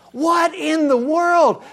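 slow, loud cry of disbelief; a stunned shout at the idea; outraged disbelief. Acted. Preacher crying out in shock at Israel's request.

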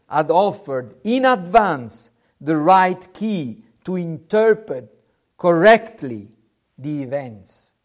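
normal talking voice

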